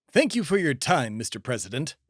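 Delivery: ordinary talk, nothing marked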